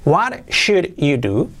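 "What should you do?" slow, each word apart; slow word-by-word model. Shown as a demonstration. Teacher models the new question for learners, slow and separated. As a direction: space the words out for learners.

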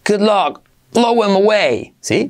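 ordinary delivery

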